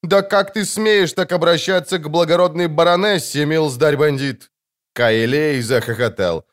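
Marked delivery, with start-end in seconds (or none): 0.0-4.5 higher outraged rebuke; indignant scolding. A narrator's reading. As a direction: scold the ruffian, affronted. Matron scolds the bandit.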